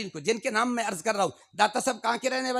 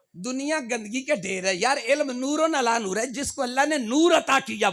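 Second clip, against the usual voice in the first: ordinary talk, nothing marked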